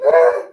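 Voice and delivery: loud frightened cry; scared yelp. Shown as a demonstration. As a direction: yelp as if cowering away. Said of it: "you might just hide, you would".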